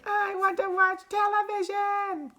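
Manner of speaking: very high, drawn-out squeal; shrill, slow tantrum squeal. A narrator's reading. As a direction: stretch it into a tiny child's whine. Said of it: "he squeaked".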